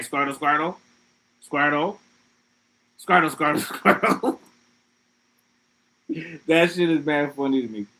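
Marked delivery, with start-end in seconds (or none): 0.0-4.4 chirpy repeated Pokemon call, rising on each Squirtle; Squirtle cry. Retold or imitated. Squirtle Squad answering interviews, imitated creature chant. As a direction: chant the name like the cartoon creature would. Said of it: "But they just saying".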